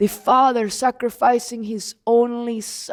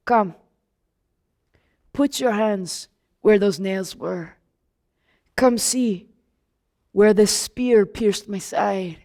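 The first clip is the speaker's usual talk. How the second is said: gentle invitation; 6 semitones lower, slower than usual. Acted. Jesus inviting doubting Thomas.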